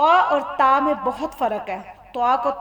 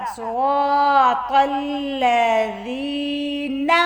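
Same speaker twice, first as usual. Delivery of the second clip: very slow, measured chant; Quran recitation; much slower than usual. Shown as a demonstration. Teacher recites the verse for learners, slow chanted recitation. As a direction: draw each long vowel out on a held note.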